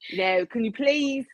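imitated British company owner, pleading; British accent. Company owner asking customers a favor, mock British accent. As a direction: put on a British accent for a polite plea.

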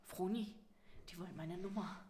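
hushed excitement. Retold or imitated. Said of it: "Und ich so".

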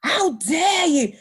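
high, loud, drawn-out indignation; imitated manager's scandalised cry; mock outrage. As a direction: stretch it out like an affronted boss. Mimics manager's outrage again.

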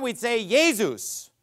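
Jesus said German style, as YAY-zoos; German pronunciation. Shown as a demonstration. Preacher showing the congregation how German speakers say the name. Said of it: "if we were speaking German".

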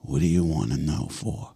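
low, slow and quiet, warily; a guarded dad lowering his voice; suspicious Italian father. Retold or imitated. Ten-year-old asks his buddy's dad his job, dad answers low and slow. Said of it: "He goes".

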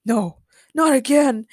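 mildly exasperated groan. A narrator's reading. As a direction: groan it in dismay. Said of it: "Patrick groaned".

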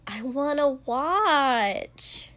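high, drawn-out whine; a petulant, stretched-out whine; whiny, pouting. A narrator's reading. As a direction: pout and whine it out slowly. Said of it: "Mina said with a whine and a pout".

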